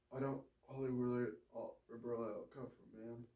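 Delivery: low, hushed and humble; soft low John Coffey. Acted. John answers the sick woman, low and hushed. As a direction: mumble it softly.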